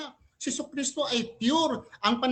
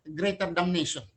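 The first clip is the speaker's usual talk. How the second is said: normal talking voice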